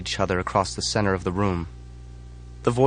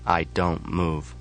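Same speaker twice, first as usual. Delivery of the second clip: ordinary delivery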